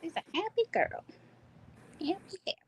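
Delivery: cooing. Real, not acted. Cooing over the doll.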